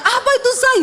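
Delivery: a high, laughing startled cry; startled yell. Retold or imitated. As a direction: cry out in alarm at the noise. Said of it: "Saya teriak". (teriakan kaget sambil tertawa)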